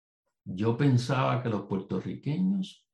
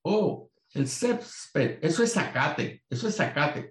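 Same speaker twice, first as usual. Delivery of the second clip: mock boast; 5 semitones higher than usual. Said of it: "Unos dicen".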